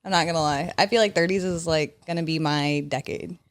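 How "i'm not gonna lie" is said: usual speaking voice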